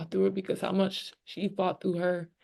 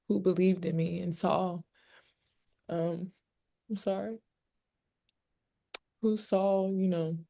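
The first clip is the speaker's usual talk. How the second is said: choked up. Real, not acted. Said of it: "I don't know why I'm so emotional".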